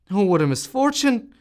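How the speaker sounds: high, loud wail of dismay; cry of dismay. A narrator's reading. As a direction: cry out in horror. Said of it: "cried the landlord".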